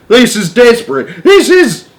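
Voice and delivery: high, loud, panicked cry cut off; rising panic until the line cuts; desperate cry. A narrator's reading. Ground control pleads before being cut off, frantic.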